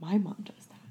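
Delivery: unvoiced whisper of recognition; whispered realization. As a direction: breathe it like a secret. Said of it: "been like".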